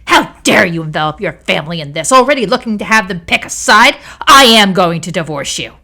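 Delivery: loud furious tirade. A narrator's reading. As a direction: shout it at her, outraged. Nina furious at wife.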